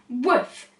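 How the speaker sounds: ordinary delivery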